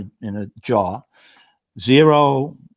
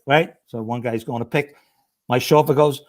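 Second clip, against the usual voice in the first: usual speaking voice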